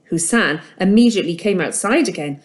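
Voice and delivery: plain reading voice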